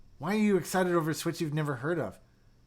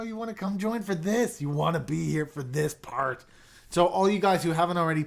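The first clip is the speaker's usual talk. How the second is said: ordinary talk, nothing marked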